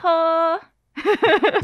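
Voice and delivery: bright high call-out of a catchphrase; iconic show greeting. Shown as a demonstration. Guest demonstrates her video opening, called out excitedly. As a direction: sing out your video opener. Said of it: "a very iconic opening".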